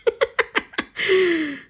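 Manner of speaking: giggling, high-pitched; high laugh. Real, not acted. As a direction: laugh then call out honey. Laughing at herself.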